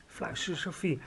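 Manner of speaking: usual speaking voice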